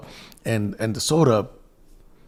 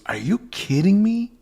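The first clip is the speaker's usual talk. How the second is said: stunned disbelief; 10 semitones higher, 4 dB louder than usual. Retold or imitated. Homeless man stunned by offer.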